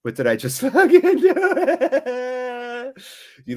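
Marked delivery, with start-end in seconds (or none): 0.6-2.9 high, slow laughing disbelief. Real, not acted. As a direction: laugh through the words in shock. Stunned at own mistake.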